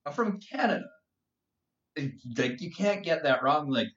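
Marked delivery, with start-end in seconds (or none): 0.1-0.9 high-pitched, insistent retelling; protesting correction. Retold or imitated. Mistaken for American in Australia, high protest. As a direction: correct them, voice pitched well up.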